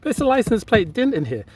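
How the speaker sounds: suppressed giggle, high and fast; fighting back laughter; trying not to laugh. Real, not acted. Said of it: "I'm trying not to laugh".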